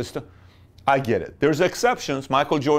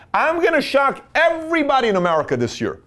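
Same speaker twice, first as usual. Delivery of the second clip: high, mock-grand voice of the man upstairs; the man upstairs declaring his surprise, in a high mock-grand voice; voice of God; 9 semitones higher, 4 dB louder than usual. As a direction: announce it like a playful god above. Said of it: "the man upstairs is watching saying".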